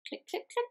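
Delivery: high quick clicking noises; mouth-made clicks. Acted. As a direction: click like typing keys. Typing sound effect.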